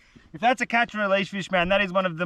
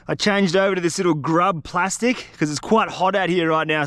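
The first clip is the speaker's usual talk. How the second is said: normal talking voice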